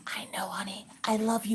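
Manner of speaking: whispered goodbye to daughter; hushed mom slipping out the door. Retold or imitated. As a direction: sneak out in a whisper. Mom leaving daughter with class.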